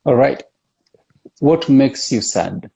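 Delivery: ordinary talk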